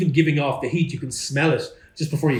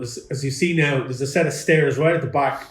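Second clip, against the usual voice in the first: ordinary delivery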